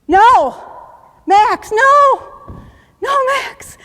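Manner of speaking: high, loud, desperate yells of a name; anguished shout. Retold or imitated. Soldier yells for his buddy after the explosion, anguished shout. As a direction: scream it as your buddy dies. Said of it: "and he goes".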